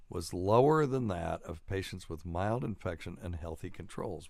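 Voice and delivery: ordinary delivery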